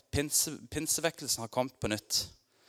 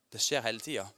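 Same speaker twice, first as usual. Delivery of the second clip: normal talking voice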